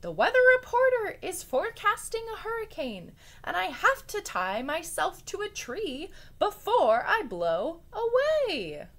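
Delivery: high, loud hurried alarm; frantic warning. Acted. Rabbit warning the bear of the storm, loud and frantic. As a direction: keep the panic up, urgent.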